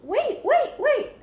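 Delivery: high, loud "wait, wait, wait"; shouting. Acted. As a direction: call out to stop the dragon. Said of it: "shouted Elizabeth".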